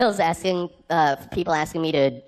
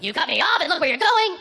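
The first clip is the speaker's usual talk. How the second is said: imitated angry yelling; 12 semitones higher than usual. Truck driver to the man who cut him off, mock furious yelling. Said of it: "he started yelling".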